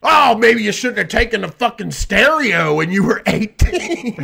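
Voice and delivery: high, nagging mock-parent whine; high whiny parody of a scolding parent. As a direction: sneer it like a resentful parent. Mocking parents who gave stuff.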